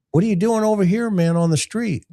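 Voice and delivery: high, breezy fake surprise; playful faux-casual cop; mock innocent cop. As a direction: act like you just bumped into him. Cop feigning surprise at suspect.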